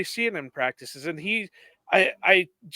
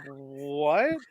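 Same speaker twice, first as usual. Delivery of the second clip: retold bewilderment; 2 semitones lower than usual. Imitating the rookie's reaction to kids' nickname, mock bewilderment. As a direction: stretch it into a baffled mock query. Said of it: "He's just looking like".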